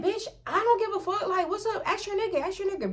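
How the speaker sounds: high sassy taunt, mocking her rival. Baby mama number two taunting the girlfriend on the phone, high sassy. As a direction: toss it back with dismissive attitude.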